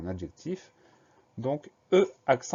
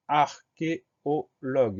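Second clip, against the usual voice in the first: slow, higher, each syllable of "archéologue" set apart; syllable-by-syllable dictation; 5 semitones higher, 4 dB louder, much slower than usual. Shown as a demonstration. Teacher introducing the next spelling word to pupils, slow syllable-by-syllable dictation. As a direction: say "archéologue" very slowly, in separate syllables.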